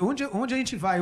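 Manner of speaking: ordinary talk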